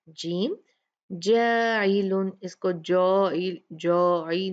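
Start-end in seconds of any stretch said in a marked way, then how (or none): 1.1-2.4 जाइलुन drawn out slowly with a thin alif, not जॉई; slow thin recitation. Shown as a demonstration. Teacher corrects students on thin alif. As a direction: stretch it slow and light. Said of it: "कैसे पढ़ेंगे?".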